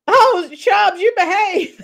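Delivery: high, loud teasing scold at a viewer; playful scolding. Real, not acted. Host teasing a chatter named Chubbs, playful scold. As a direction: wag a finger at Chubbs, laughing at him.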